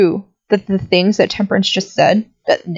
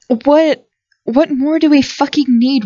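raised, exasperated demand; angry outburst; 7 semitones higher, slower than usual. Acted. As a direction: fire the question at them, fed up. Angry argument over the proof.

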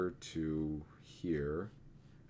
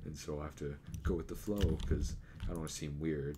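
usual speaking voice

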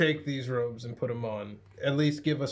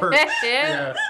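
high squeak; 9 dB louder than usual. Real, not acted. Squeaks the word.

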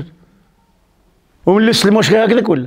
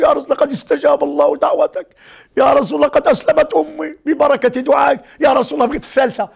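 high tearful plea; imitated weeping; 8 semitones higher than usual. Abu Hurayra telling the Prophet his mother converted, imitated weeping. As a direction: cry the good news out, high-voiced. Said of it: "يبكي، يبكي".

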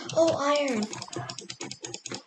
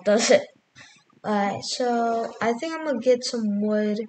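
ordinary talk, nothing marked

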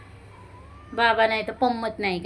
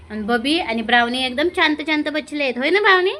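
lisping pet talk climbing high; 5 semitones higher, 4 dB louder than usual. Real, not acted. Baby talk to the pets.

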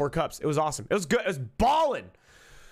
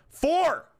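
excited shout; 12 semitones higher, 4 dB louder than usual. Real, not acted. Boasting about chugging soup.

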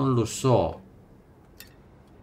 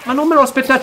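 high, loud flustered yelps; startled panic; 8 dB louder, faster than usual. Real, not acted. Player caught off guard by a sudden prompt, high alarmed yelps. Startled by quick time event.

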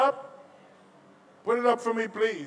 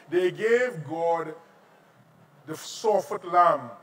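ordinary delivery

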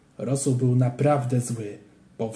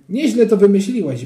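louder, delighted; pleased; 6 semitones higher, 7 dB louder than usual. A narrator's reading. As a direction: praise the idea gleefully. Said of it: "ucieszył się inny". (radosne uznanie)

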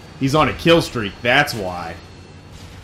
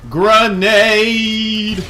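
grenade warning yell; 11 semitones higher, 7 dB louder than usual. Real, not acted. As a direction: shout it out loud and high. Calling out grenade in game.

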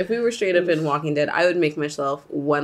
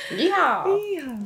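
yeehaw; 10 semitones higher than usual. Pretend. Cowboy whoop at podcast start.

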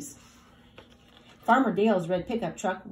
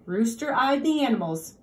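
usual speaking voice

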